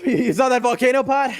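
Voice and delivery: laughing through the question; giggling with delight. Real, not acted. Laughing after big hit.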